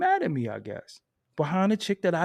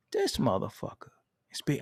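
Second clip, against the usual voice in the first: usual speaking voice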